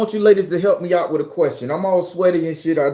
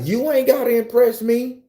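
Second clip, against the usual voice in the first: lightly voiced woman, pitched up; imitated woman's come-on; 6 semitones higher than usual. Woman calls to man doing push-ups, imitated come-on.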